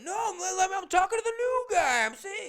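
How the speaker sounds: shrill-pitched mock whine at the crowd. Drunk barfly insisting he is just greeting, high loud mock yell. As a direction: play the moron insisting over the bar crowd.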